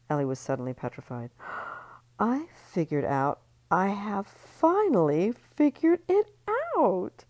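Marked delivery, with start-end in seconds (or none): 1.4-1.8 sharp gasp. Acted. Missy's sudden realization. 2.2-7.2 slow, louder, rising announcement; Missy announcing her discovery to the party; triumphant reveal. Acted.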